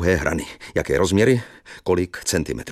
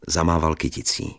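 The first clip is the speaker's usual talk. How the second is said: ordinary delivery, nothing marked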